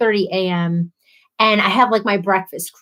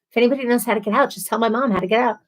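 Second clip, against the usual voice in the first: normal talking voice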